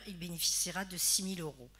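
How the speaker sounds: ordinary talk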